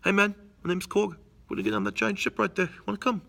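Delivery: breezy rock-alien invite; friendly Korg. Retold or imitated. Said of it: "He's like".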